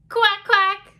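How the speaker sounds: high, loud quacks; duck quacking. A narrator's reading. Teacher voicing the duck for children, high loud quacking. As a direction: call out like a storybook duck. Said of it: "called the duck".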